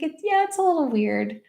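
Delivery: lightly imitated young boy, pitched high; a hint of a little kid's high voice; Emmer. Young nephew admitting to his aunt, lightly imitated high child voice. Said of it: "He's the one that's young".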